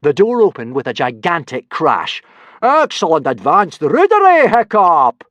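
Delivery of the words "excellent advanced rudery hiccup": high, loud booming praise; roaring. Acted. Teacher Gobber roaring loud approval at Hiccup. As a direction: bellow across the room. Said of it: "roared Gobber the Belch".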